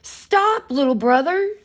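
high loud call to stop. Acted. Chick calls out to his fleeing brother, loud and high. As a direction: yell after him to stop. Calling after the egg.

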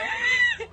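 high, squealing real laugh; helpless laugh. Real, not acted. Embarrassed before putting this on the internet, helpless laugh. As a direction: burst out laughing. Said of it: "I'm laughing so hard I'm crying".

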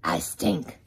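deep growly truck voice; garbage truck voice. Acted. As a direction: rumble low like a truck. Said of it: "garbage truck voice".

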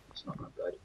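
unvoiced muttered aside; whispered aside. Real, not acted. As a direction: whisper it to yourself. Whispered aside about own game.

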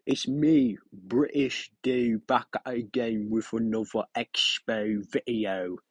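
a put-on British accent, drawn out slowly; mock British accent intro. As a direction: play a chirpy British vlogger. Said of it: "do a British accent".

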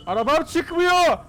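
high, slow, loud wail over the stuck car; panicked shout. Real, not acted. Car won't come out, panicking. (panikle bağırarak, uzatarak)

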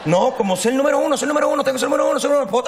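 high, fast, laughing mock brag; mocking boast. Mocking people obsessed with being number one, laughing. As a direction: rattle off the number-one mantra as a joke.

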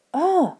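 high, voiced creature grunt; monster grunt. A narrator's reading. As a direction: grunt out an ugh as the snowman. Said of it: "the snowman grunted".

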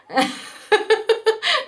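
high giggle breaking the sentence. Real, not acted. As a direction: giggle mid-thought. Laughs mid sentence.

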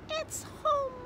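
very high little frog voice; contented young frog. A narrator's reading. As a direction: pipe it high as a frog finding home. Young frog finds new home.

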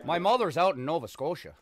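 high, loud Maritime Canadian caricature; put-on Canadian accent. Gamer demonstrating to teammates, exaggerated Canadian accent. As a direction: play up a Canadian East Coast accent. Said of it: "The Canadian accent".

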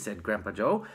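ordinary delivery

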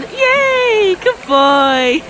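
loud, drawn-out cheer for a dog; delighted praise. Real, not acted. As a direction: cheer the dog on, starting high.

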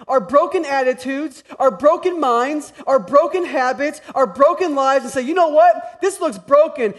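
loud, high, driving preacher cadence; full-force preaching. Acted. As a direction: build it up and preach it big.

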